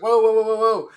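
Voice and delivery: high, loud string of whoas; loud, rapid-fire alarm; retold alarmed interjection. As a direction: cut in urgently to stop a fight, re-enacted. Acting out stepping into a brewing fight.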